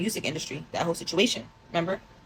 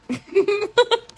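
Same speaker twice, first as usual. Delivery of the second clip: burst of laughter; 6 dB louder than usual. Real, not acted. Laughs at her own text.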